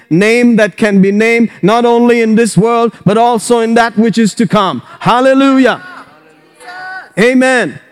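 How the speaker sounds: high, ringing declaration building to hallelujah; full-force preaching. Real, not acted. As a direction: proclaim each power like a rally cry.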